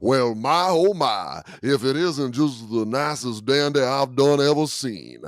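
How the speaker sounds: exaggerated Southern accent on a flirty line; Foghorn Leghorn. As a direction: play a blustery Southern gentleman. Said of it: "gone full foghorn leghorn".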